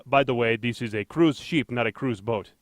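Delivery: a weak stab at a Norwegian captain; mild mock Norwegian ship captain; bad Norwegian accent. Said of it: "I didn't do that accent very well".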